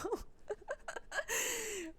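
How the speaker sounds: high-pitched giggling fit; high laugh. Real, not acted. Laughing at her own video story, high giggle. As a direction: laugh helplessly, high and trailing.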